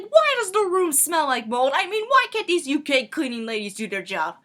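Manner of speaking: loud, high-pitched irate dad; acted-out outraged dad; indignant complaint. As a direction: grumble loudly like a fed-up father. Dad complains about the smell.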